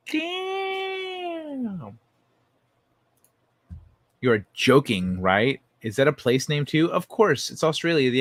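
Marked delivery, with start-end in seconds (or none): 0.1-2.0 held falsetto damn, sliding down at the end; comic high-pitched howl; long high wail. Pretend. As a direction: stretch it into a wailing cry. Exaggerated drawn-out reaction.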